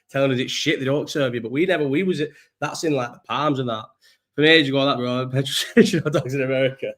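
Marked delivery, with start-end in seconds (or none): none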